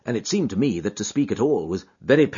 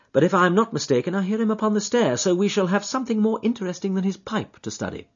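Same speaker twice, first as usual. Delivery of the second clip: brisk, lightly raised detective voice, pleased with his deduction; Holmes explaining; 9 semitones higher than usual. Acted. Holmes to Watson, reading a pipe, confident detective voice. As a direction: lay out the clues like a confident sleuth.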